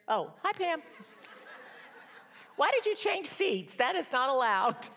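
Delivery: high, playful mock scolding of a friend. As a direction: tease her like a fussy hall monitor. Teasing a friend who moved seats.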